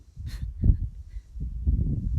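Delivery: loud, breathy burst of laughter; real delighted laugh; joyful laugh. Real, not acted. Laughing at own joy.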